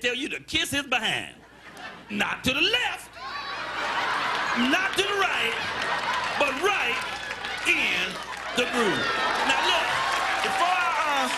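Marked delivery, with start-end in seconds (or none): 2.1-11.3 cocky comeback. Acted. Rude customer throws back catchphrase.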